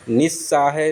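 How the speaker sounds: निस्सहाय misspoken as "nis-saahe"; wrong swallowed syllable. Shown as a demonstration. Teacher showing first error, निस्सहाय as "nissahe". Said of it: "निस्सहाय नहीं होता".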